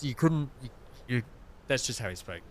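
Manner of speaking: usual speaking voice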